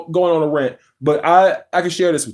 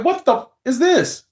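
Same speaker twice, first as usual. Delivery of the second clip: higher, imitating a stunned reaction; mock disbelief; 8 semitones higher than usual. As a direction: replay the shock of the live getting shut down. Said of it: "I'm like".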